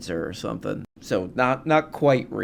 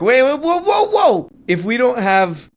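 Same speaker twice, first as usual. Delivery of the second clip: high, loud imitation of protest; mock alarm; 12 semitones higher, 7 dB louder than usual. Host mimicking a debater who objects, mock alarm. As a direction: cut in with hammed-up panicky whoas.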